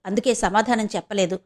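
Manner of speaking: ordinary delivery, nothing marked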